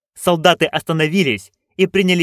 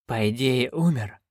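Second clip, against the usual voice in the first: softer, faintly husky reply; slightly hoarse; 6 dB quieter than usual. A narrator's reading. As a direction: answer weakly with a touch of huskiness. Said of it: "с некоторой хрипотцой в голосе". (с лёгкой хрипотцой)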